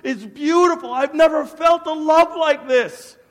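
loud, high, impassioned amazement; overwhelmed wonder. Retold or imitated. Man astonished at his sudden love, overwhelmed. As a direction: cry it out as if flooded by love.

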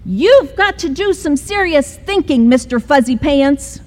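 high, stern mom voice; mother scolding her son, high and stern; lecturing mother. Acted. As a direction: tell him firmly to think it over. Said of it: "said his mother".

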